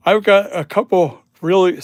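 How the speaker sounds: words stretched through a loud yawn; yawning mid-sentence, tired after a long night. Real, not acted. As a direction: talk while a big yawn takes over. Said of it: "sorry for yawning".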